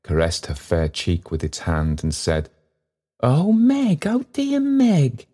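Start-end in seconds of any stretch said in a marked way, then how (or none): 3.2-5.3 high, slow child's voice, a hint of tears; a small child clinging, moved; a touch of sobbing child. Child clasping Meg's neck, a touch of sobbing. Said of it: "in a burst of sobbing".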